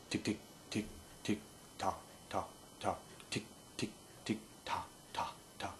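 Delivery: "tick" and "tock" whispered soft and rhythmic; whispered feed chuckle. Shown as a demonstration. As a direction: whisper a ticking duck chuckle. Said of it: "one that goes".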